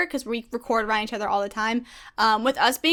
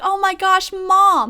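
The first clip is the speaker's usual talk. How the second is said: high, loud adoring gush; light up at the sight of her; 7 semitones higher, 6 dB louder, much slower than usual. Retold or imitated. Fan seeing the team's mom figure, gushing. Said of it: "I'm like".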